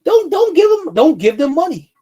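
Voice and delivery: real anger boiling over; furious shout. Real, not acted. Furious call to boycott.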